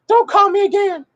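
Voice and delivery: high mock-angry falsetto; mad, irritated. Woman cutting off her fiancé, high-pitched irritated mock outburst. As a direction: squeal it as the fed-up woman. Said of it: "She's mad, irritated".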